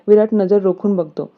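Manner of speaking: usual speaking voice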